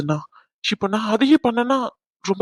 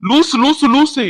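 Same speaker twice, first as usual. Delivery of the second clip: scolding; 6 semitones higher, 7 dB louder, much slower than usual. Acted. Husband scolding his wife.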